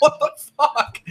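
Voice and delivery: high, laughing mock shock; laughing mock outrage. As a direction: laugh through a fake outraged what. Reacting to insult, laughing.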